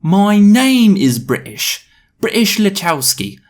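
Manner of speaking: high, loud, hammed-up British voice; put-on British accent. British boy declaring his name, exaggerated British accent. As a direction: overdo a Russell Brand-style British accent. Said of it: "I'm sticking with the accent".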